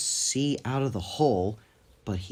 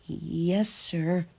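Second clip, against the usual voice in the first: higher, half-whispered timid reply; nervous Thomas; 7 semitones higher than usual. A narrator's reading. Thomas answers the Fat Controller, nervous and hushed. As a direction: shrink from the boss. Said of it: "said Thomas nervously".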